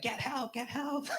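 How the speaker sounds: high, soft mock-panicked wife; wife's urgent plea. Wife to husband as son is stuck, mock urgent plea. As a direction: imitate a worried wife pleading. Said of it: "she's looking at me and she's like".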